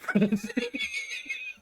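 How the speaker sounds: high laugh breaking out; bursting laugh. Real, not acted. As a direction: finally let the laugh burst out. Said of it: "I could barely finish that without laughing".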